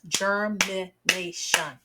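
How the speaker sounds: Germination stretched slowly into four parts; slow segmented. Shown as a demonstration. Teacher segmenting syllables for children, very slow. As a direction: segment it slowly, ger-mi-na-tion. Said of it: "When I segment the word germination, I hear four parts".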